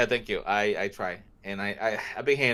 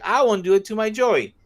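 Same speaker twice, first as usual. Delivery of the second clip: high, loud Joey voice; imitating Joey; 12 semitones higher, 6 dB louder than usual. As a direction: swear it like Joey. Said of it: "He's, he's like".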